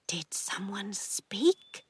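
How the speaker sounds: slow, hushed questioning whisper. A narrator's reading. Aunt hearing a voice from the pipe, whisper. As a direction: whisper it cautiously, drawing it out. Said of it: "heard her Aunt Pauline whisper the words".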